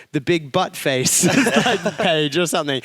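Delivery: can't get the words out for laughing; giggling while quoting the cartoon guy; laughing through the quote. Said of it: "And he goes".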